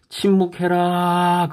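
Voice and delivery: high, stretched-out order; preacher's acted commandment; drawn-out command. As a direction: proclaim the order slowly, holding it high.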